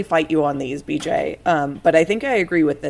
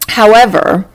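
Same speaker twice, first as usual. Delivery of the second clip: indignant; 8 dB louder than usual. Real, not acted. Louder indignant rant about dashes.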